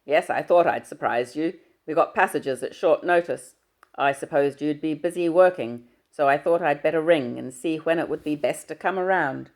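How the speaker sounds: booming fatherly hello down the line. Acted.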